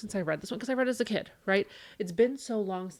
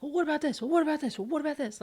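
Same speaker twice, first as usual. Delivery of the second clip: put-on high, pleading kid voice, asking over and over; eager kids; 9 semitones higher, faster than usual. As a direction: play the children begging for answers, pitched up. Kids bursting with questions at end.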